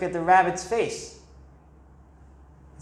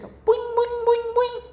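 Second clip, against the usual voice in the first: very high squeaky boings; bouncing boing sound. Acted. Teacher making bean-bouncing noises for kids.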